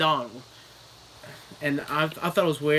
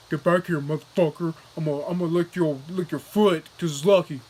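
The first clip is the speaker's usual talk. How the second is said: put-on voice of a dog in pursuit; chasing dog; 4 dB louder than usual. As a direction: threaten the rabbit as a talking dog. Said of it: "he's chasing after this bunny rabbit".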